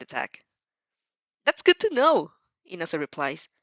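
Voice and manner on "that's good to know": put-on higher, louder eager young man; a fired-up rival promising to win; hammed-up hot-blooded boy on the phone; passionate boy. Inasa voiced by reader.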